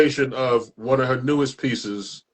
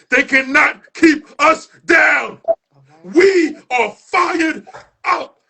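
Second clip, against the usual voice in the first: high, slow, crying shout; tearful shout; 5 dB louder, slower than usual. Acted. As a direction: cry it out as a rallying call. Poem climax, crying shout.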